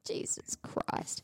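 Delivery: whispered, slow, fed-up sigh; whispered exasperation. Real, not acted. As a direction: breathe it out under your breath. Exasperated aside to herself.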